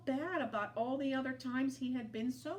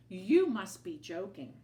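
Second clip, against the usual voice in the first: scoffing; 8 dB louder than usual. A narrator's reading. Owl scoffs.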